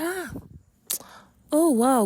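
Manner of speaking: amazed. Pretend. Imagined listeners amazed.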